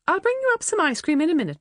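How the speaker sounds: quick, high and eager; fussing mum. A narrator's reading. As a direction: rattle it off like a fussing mother. Mum fussing over Helen.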